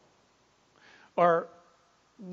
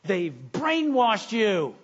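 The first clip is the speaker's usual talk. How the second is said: drawn-out, raised exclamation; higher, stretched cry of alarm, acted; mock exclaiming mother; 5 semitones higher, 4 dB louder, slower than usual. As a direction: exclaim it slowly like an alarmed mom. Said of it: "His mom exclaimed".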